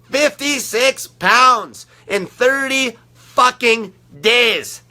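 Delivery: slowed right down, every word hammered; slow emphatic repeat. Real, not acted. Repeating the weight loss to viewers, slow and emphatic. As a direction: spell the number out slowly so it lands.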